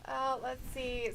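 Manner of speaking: ordinary talk, nothing marked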